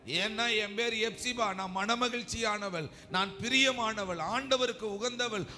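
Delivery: high, impassioned preaching proclamation; emphatic declaration. Acted. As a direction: declare it with rising conviction for the congregation. Preacher voices a defiant faith declaration. (உயர்ந்த குரலில் உறுதியான அறிக்கை)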